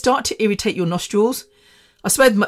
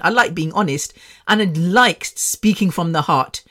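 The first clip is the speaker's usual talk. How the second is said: ordinary delivery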